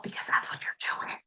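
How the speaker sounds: whispered, intimate. Real, not acted. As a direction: lean in and whisper. Drops to a whisper.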